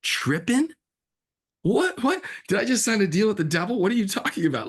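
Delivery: very high, loud rising disbelief; incredulous squeal. Retold or imitated. Filmmaker hearing the title Trippin', incredulous squeal. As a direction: shriek the title back in horror. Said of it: "You're like".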